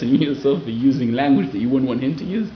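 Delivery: ordinary delivery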